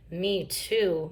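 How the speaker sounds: ordinary delivery, nothing marked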